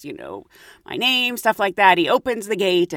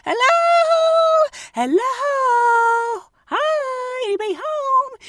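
imagined calling out; 14 semitones higher, much slower than usual. Retold or imitated. Imagining calling out at the dinosaur gate, high hopeful calls. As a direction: call toward the empty gate.

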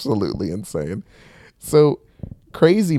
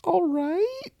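much higher, unsure voice; hesitant Shun; much slower than usual. Retold or imitated. Shun unsure about the crazy guy, reluctant and high. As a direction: squeak out a reluctant yes. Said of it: "And Shun is like".

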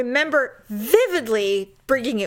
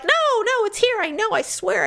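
much higher, flustered pleading; frantic insistence; 11 semitones higher than usual. Retold or imitated. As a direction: insist in a panic. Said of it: "And I'm like".

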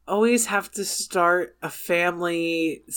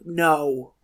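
normal talking voice

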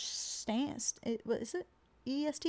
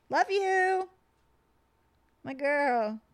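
cooing; 4 semitones higher, much slower than usual. Acted. Cooing at the house ghost.

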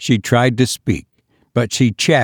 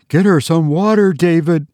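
sobbed; 6 semitones higher than usual. Acted. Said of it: "sobbed her mother".

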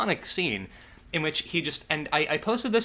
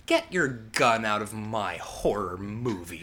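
mock indignant; 5 semitones lower, slower than usual. Real, not acted. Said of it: "I gotta tell you".

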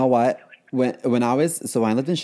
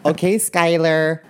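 high, louder, sing-song yuppie mom; 7 semitones higher, 4 dB louder than usual. Retold or imitated. Yuppie parent calling her kids, high and sing-song. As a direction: call out like a rich suburban mother.

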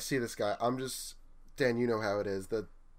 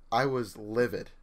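ordinary talk, nothing marked

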